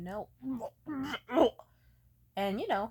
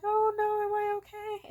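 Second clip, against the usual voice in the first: much higher, louder frightened plea; terrified, asking for reassurance; panicked; 13 semitones higher, 9 dB louder than usual. Retold or imitated. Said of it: "I'm just like".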